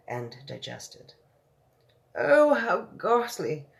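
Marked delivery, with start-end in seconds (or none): 2.1-3.7 high, loud, slow Oh, how ghastly; horrified cry. Acted. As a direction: recoil in horror. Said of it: "the famous voice cried out".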